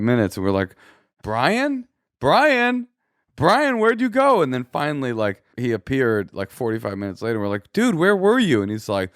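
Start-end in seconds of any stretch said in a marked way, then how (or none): 1.2-4.4 high drawn-out call, searching for someone. Retold or imitated. Couple looking for the brother who disappeared, calling out. 7.7-8.6 exasperated demand. Retold or imitated. Retold confronting of the brother.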